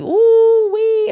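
high, loud ooh wee; excited squeal. Real, not acted. Host to listeners, picturing the drought ending, squealing.

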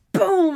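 loud, high burst on "boom"; booming crash noise. Retold or imitated. Host acts out Honest Jake knocking down the wall, booming. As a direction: imitate a sudden demolition blast.

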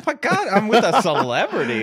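giggling, high and delighted; laughing. Real, not acted. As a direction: laugh through it in mock awe. Teasing reaction to tour voice.

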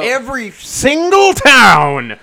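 booming slow sportscaster call; Marv Albert. Pretend. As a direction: shout it like a big play-by-play call. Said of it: "Marv Albert's gonna bring down and describe".

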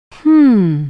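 a long, high, loud drawn-out hum; drawn-out hmm. A narrator's reading. Daughter drags out a doubtful hmm at her mother. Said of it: "இழுத்தாள் Yazhini".

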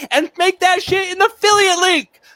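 laughing through the words; cracking up while talking. Real, not acted. Laughs at his own joke.

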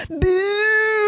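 high, drawn-out mock boo; crowd boo. Pub crowd jeering a man having dinner, high boo. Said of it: "everyone's like".

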